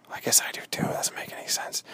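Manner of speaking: sheepish whispered aside. Pretend. Hunter whispering an admission. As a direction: mumble it as a secret.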